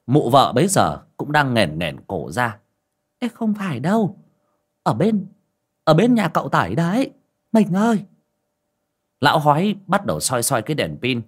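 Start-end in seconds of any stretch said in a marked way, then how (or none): 3.2-8.0 wife's voice. Acted. Wife calls out to husband.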